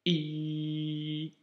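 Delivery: i held long after a throat catch; block, then draw out the i; long i opened by a glottal stop. Shown as a demonstration. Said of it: "et ici".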